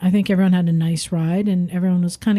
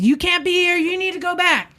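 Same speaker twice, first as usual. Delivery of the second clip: high, angry imitation of the fuming man; mock angry yell; 10 semitones higher than usual. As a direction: snap at the riders to leave. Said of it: "he's just fuming".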